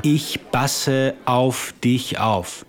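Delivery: slow, careful "Ich passe auf dich auf"; teacher correcting the learner slowly; slow model phrase. Shown as a demonstration. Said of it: "You would say".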